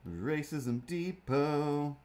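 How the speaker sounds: slow, put-on announcer voice; sarcastic shop name announcement; mock slogan. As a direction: read it like a store jingle. Comic mock store slogan.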